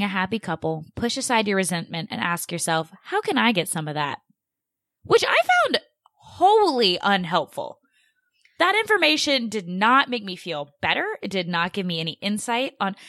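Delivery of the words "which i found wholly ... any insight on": heated. Real, not acted. Host objects to the therapist's advice, heated. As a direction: protest with emphatic exasperation. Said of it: "this gets me heated".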